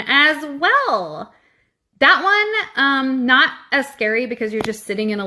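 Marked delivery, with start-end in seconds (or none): none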